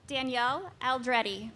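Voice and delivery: projecting names across the hall; firm carrying classroom voice; teacher voice. Real, not acted. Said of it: "I have to use my teacher voice".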